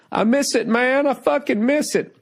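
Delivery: high, pained cry of missing it; yearning outburst. Real, not acted. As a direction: let it burst out how much you miss it. Longing outburst about stand-up.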